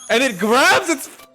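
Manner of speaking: loud, high-pitched exclamation; worked-up play-by-play yell; excited outburst. Real, not acted. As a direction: blurt out the big moment, voice leaping up. Excitedly narrating the monkey's grab.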